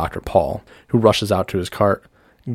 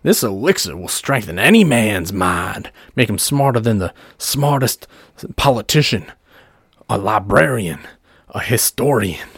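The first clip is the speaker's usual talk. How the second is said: showman's sales pitch, higher and emphatic; pumping up the crowd; 5 semitones higher, 3 dB louder than usual. Acted. Doctor Paul pitching his elixir to the gathered crowd, rousing showman. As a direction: build it like a snake-oil huckster. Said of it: "As he pumps up the crowd".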